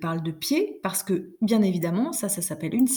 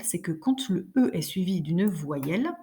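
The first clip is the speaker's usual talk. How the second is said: ordinary talk, nothing marked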